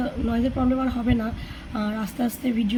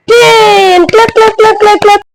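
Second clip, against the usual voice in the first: excited cheering; 10 semitones higher, 19 dB louder than usual. Real, not acted. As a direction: celebrate the finish, clapping along. Cheering child after counting. (উচ্ছ্বসিত হাততালির ডাক)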